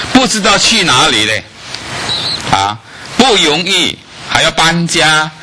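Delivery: high, pressing sermon voice; impassioned preaching. Acted. Preaching about leaving Haran.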